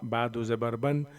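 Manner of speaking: normal talking voice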